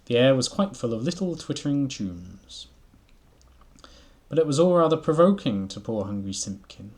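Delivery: lively storybook reading voice; story narration. A narrator's reading. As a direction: read it like a bedtime story. Reading Beatrix Potter aloud.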